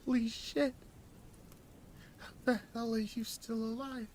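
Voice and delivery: high, strained disbelief; stunned as if seeing a ghost; choked shock. Acted. Hostage recognising the narrator, high choked shock. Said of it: "He choked".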